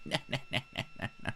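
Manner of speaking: high, laughing no no no. Real, not acted. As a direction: giggle out the refusals. Laughing mock refusal.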